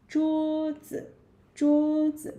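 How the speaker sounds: 桌子 with high level 桌, short 子; long flat high 桌, light 子; first plus neutral tone. Shown as a demonstration. Teacher drilling the word, 桌子 in first and neutral tone. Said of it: "first tone, neutral tone".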